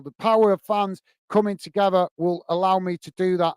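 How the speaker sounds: ordinary talk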